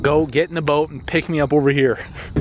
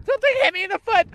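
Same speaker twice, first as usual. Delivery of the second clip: fast, high, panicked yelp; 3 dB louder, much faster than usual. Real, not acted. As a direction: blurt it out in a fright. Hit by something unseen.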